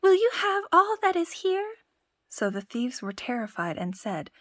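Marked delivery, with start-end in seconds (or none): 0.0-1.8 high, loud shout; loud cry. Acted. Tom Thumb shouts from inside the parson's room, high and loud. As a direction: bellow it to wake the house. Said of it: "cried out with all his might".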